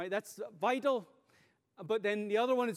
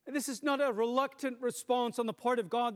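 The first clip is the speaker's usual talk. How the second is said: ordinary talk, nothing marked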